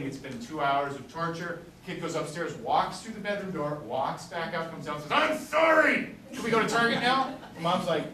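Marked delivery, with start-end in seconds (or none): 5.1-7.3 put-on a child blurting sorry to get a treat; eager kid apology. Child's quick loud apology.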